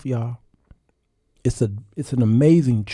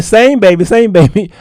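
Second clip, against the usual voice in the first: loud, higher encouraging chant; cheering wife; 7 semitones higher, 10 dB louder than usual. Retold or imitated. Wife cheering her husband's song, loud and high. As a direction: cheer him on like a hype woman.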